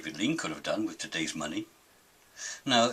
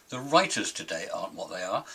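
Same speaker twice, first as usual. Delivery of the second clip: ordinary talk, nothing marked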